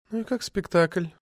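feigned indifference, quietly testing; ominous indifference. A narrator's reading. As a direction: ask it too casually. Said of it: "С равнодушием, не обещавшим ничего хорошего".

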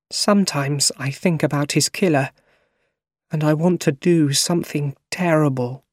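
grieving aunt, close to crying, without sobs; tearful. A narrator's reading. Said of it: "Then she started crying".

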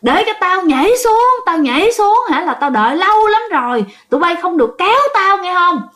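a man gripping a pillar shouting to be let jump; possessed man yelling. Retold or imitated. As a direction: bellow at the crowd not to pull him down. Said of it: "Rồi ổng mới la".

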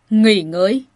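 loud, incredulous echo; coldly indignant. A narrator's reading. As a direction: throw the question back loudly. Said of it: "Hán tử lạnh lùng cất cao giọng".

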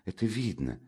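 soft, worried hush; dropping to a near whisper; frightened whisper. Acted. Frightened sister fearing for her brother, hushed. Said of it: "шепнула испуганная Дуня".

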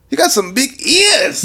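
high, slow, accented clerk impression; imitated store clerk. Store clerk remarking on a customer's ears, imitated high voice. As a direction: put on a high-pitched, drawn-out clerk voice. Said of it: "the clerk, he's all".

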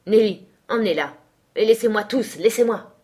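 losing composure, insisting on being left alone; agitated dismissal. A narrator's reading. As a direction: send them all away urgently. Master dismisses everyone after the clash.

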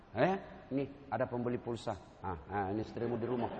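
low, soft, kindly superior; komandan pengayom. Acted. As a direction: talk gently to a junior about his family. Acts kindly commander with junior. (komandan menyapa anak buah dengan lembut)

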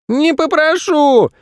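very high, drawn-out refusal; boy shouting. A narrator's reading. Boy refusing to apologise to mum, shouting. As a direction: squeal it slowly in a child's voice. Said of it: "крикнул Федя".

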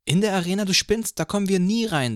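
mock him as a young girl, up high; girl scoffs at the boy, high and loud; girl scoffing. A narrator's reading. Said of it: "sagte sie".

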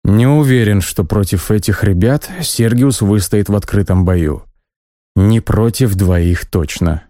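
plain reading voice

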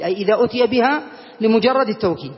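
ordinary talk

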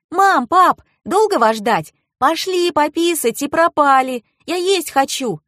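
high-pitched impatient daughter; young girl. A narrator's reading. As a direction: pipe up as a child pestering the parents. Narrator voices twelve-year-old girl.

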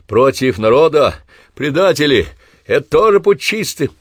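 high, loud indignant shouting; crowd clamor. Acted. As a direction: hurl the accusation at soldiers. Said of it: "загалдела".

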